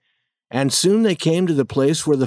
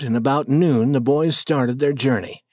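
plain reading voice

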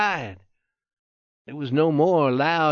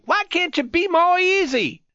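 much higher-pitched irritated protest; angry, sharp; 14 semitones higher than usual. A narrator's reading. Wounded youth to friend handling his wound roughly, sharp and angry. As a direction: snap it sharply in a high, cross voice. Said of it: "he said angrily".

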